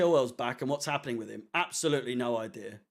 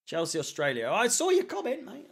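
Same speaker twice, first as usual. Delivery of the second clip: much higher, playful jab at a viewer; teasing call-out; 6 semitones higher than usual. Real, not acted. Streamer teasing a viewer from Australia, pitched high. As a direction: catch him out, pitch it way up.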